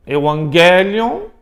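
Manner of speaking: normal talking voice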